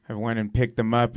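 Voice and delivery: normal talking voice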